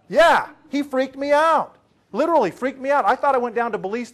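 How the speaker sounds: excited, emphatic retelling; emphatic exclaim. Real, not acted. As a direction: drive home how rattled you were. Speaker stressing how shaken he was.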